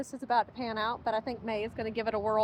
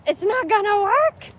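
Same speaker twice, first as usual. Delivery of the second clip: surprised outburst; 9 semitones higher, 12 dB louder than usual. Real, not acted. Sled fails on wet sand.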